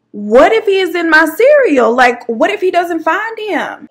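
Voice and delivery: high, loud alarmed wail; panicked. Retold or imitated. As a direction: freak out, voice shooting up. Panicking about missing roach.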